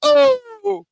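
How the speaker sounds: high loud startled yelp; a sudden shocked cry. Real, not acted. Reacts to the kinky line.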